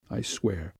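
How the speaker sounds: very soft, earnest oath; quiet, near-whispered promise; hushed vow. A narrator's reading. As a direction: swear it under your breath. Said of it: "went on in a whisper".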